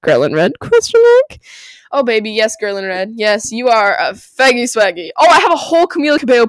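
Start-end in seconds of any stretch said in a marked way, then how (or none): 0.0-1.4 mock surprise. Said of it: "it's like".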